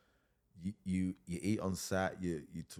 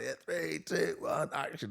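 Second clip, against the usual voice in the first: giddy countdown; 12 semitones higher, 5 dB louder, slower than usual. Retold or imitated. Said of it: "I was like".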